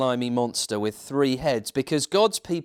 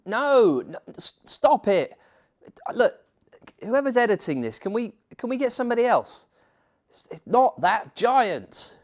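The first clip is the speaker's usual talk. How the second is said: frustrated protest; 9 semitones higher than usual. Real, not acted. Narrator begging the editor to fix the picture, high and exasperated. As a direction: beg them to stop, fed up.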